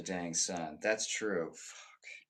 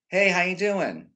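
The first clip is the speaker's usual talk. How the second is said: high, loud imitation of a breezy hello; mock greeting; 9 semitones higher, 8 dB louder than usual. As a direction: call out a token greeting, imitating others. Imitates people's routine insincere greeting.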